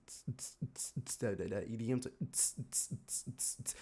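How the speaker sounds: soft whispered hi-hat tss sounds; imitated beat. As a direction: mimic the muffled EDM beat. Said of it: "the beats of the".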